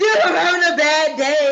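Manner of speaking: high, loud whining complaint; whiny plea. Retold or imitated. Wife whining to husband on a bad day, loud and high. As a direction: whine it out like a sulking kid. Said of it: "And I'm like".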